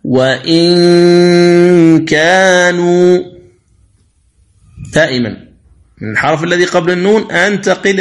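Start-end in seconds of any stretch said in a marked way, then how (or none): 0.0-3.2 وإن كانوا recited slowly, the نون hidden in a long nasal hum; slow recitation of وإن كانوا; light nasal ghunna. Shown as a demonstration. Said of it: "قوله تعالى".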